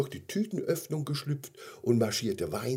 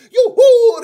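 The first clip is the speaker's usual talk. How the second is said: very high, loud whoop; joyful cheer; 18 dB louder than usual. Acted. Gummy bear invited to stay, jubilant high whoop. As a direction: squeal out a jubilant yippee. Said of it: "hüpfte vor Freude in die Höhe".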